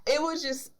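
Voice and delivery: usual speaking voice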